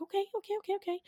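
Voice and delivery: high, soft run of words; mock placating. [name] giving in to Ruth backstage, soft high placating.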